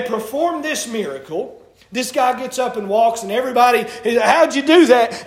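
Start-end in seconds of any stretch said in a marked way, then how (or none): 4.2-5.2 loud, higher-pitched astonished outburst; amazed question. Retold or imitated. Crowd asking Peter about the miracle, loudly amazed. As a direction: exclaim it like an astounded onlooker.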